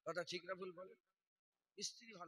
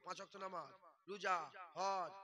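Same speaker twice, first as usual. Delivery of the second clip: usual speaking voice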